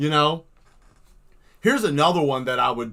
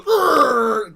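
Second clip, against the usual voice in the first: loud, raised monster growl; mock monster growl; 8 semitones higher, 8 dB louder than usual. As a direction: growl like a drawn beast crawling out. Drawn monster comes out.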